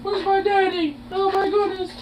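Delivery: tiny, very high, soft squeaky voice; pet's voice. Acted. Voicing the pet looking for dad, tiny squeaky voice. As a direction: squeak softly as an excited pet. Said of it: "You're like".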